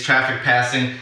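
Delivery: normal talking voice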